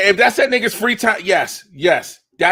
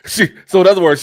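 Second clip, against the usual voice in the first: normal talking voice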